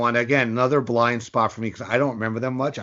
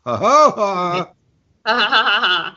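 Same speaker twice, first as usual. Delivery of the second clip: mock laugh; 9 semitones higher, 5 dB louder, much slower than usual. Mock laugh after pun.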